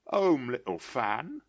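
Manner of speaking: put-on a lonely boy hardly believing; a hint of a young boy; lightly voiced boy. A narrator's reading. Boy answers sister.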